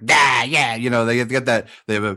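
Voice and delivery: light mock Long Island accent on yeah yeah; lightly imitating a Long Island yeah. Host showing how New Yorkers supposedly sound, light Long Island accent. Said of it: "that's the Long Island accent".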